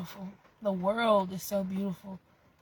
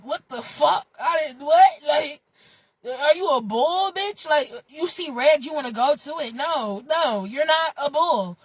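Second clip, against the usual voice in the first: exasperated; 6 semitones higher, 5 dB louder than usual. Real, not acted. Exasperated rant at listeners.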